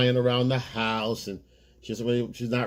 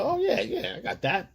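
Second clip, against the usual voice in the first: much higher, laughing through "yeah, yeah"; laughing mock eager yes; 9 semitones higher than usual. Mocking the boyfriend who pays, laughing. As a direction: play the eager pushover, chuckling. Man eagerly agrees to pay.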